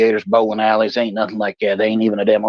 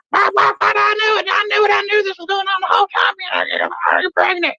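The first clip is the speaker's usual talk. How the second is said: very high, shouted mother; mother shrieking at her daughter. Retold or imitated. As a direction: yell it like a furious mom.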